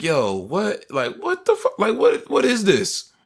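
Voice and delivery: high-pitched, incredulous protest; retold disbelief. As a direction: act out baffled disbelief, pitch shooting up.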